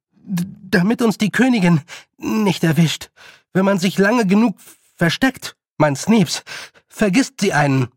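high, halting bursts with a stammer; breathless explanation. Acted. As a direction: explain in gasps while running. Panting boy explains while fleeing. (atemlos, stockend, gehetzt)

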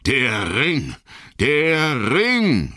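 shrieking wraiths, building; cutting cries. Acted. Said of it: "schrien sie mit schneidenden Stimmen".

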